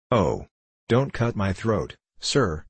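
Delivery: ordinary delivery, nothing marked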